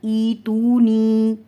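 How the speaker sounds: ईतूनी loud, each vowel held long; ya madd. Shown as a demonstration. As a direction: recite ईतूनी stretching the final ee. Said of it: "इसी तरह देखें या मद्दा का". (ईतूनी, या मद्दा खींचकर)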